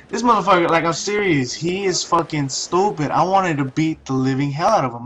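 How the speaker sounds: normal talking voice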